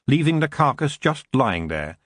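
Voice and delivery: usual speaking voice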